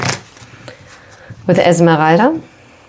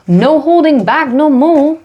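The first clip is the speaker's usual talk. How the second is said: sassy declaration; 9 semitones higher than usual. Real, not acted. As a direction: belt it out like a sassy catchphrase. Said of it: "I don't know why I said it like this".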